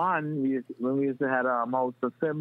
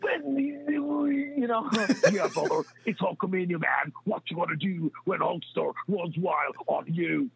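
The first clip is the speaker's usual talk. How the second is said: slow, drawn-out hyped wrestler promo, a bit higher; wrestler impression; 5 semitones higher than usual. Childhood impression for schoolmates, hammed-up wrestler promo. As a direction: ham up Hulk Hogan's wrestling promo. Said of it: "I used to do the wrestlers".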